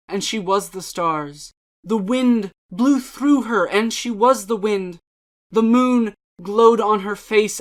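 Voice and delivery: measured, expressive recital of poems and prose; dramatic poetry reading. Acted. Channel host reading poems aloud for viewers, dramatic reading. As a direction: read each verse with feeling, letting lines breathe.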